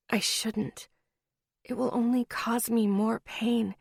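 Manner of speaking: plain reading voice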